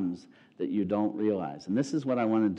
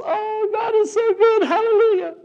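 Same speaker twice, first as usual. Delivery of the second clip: soar up in rapture; ecstatic praise; 8 dB louder than usual. Retold or imitated. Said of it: "she goes".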